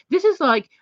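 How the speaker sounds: sharp, rising emphasis before the quote; emphatic, high. Real, not acted. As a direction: burst up high on "This is like". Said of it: "emphatic build-up".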